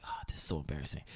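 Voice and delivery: low, soft grumble to himself; embarrassed aside. Retold or imitated. Changing in the bathroom for yoga, embarrassed aside. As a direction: drop it down like a private embarrassed thought. His own thought while changing.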